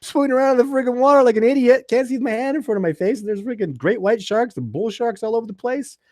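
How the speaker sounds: very high and loud, breathless ranting; frantic disbelief. Retold or imitated. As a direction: rant like you can't believe you survived. Retelling terror of sharks.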